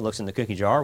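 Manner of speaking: ordinary talk, nothing marked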